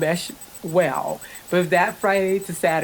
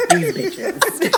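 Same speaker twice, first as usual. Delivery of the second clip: much higher, laughing grumble; mimicking the betrayed preteens, high and laughing; mock disgusted preteen; 10 semitones higher than usual. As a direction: do the kids' outraged muttering in a high voice.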